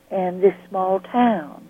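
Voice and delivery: normal talking voice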